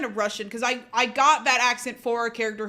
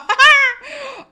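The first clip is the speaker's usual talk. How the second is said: real high-pitched burst of laughter; loud high laugh; 14 dB louder than usual. Real, not acted. Laughs at own theft joke.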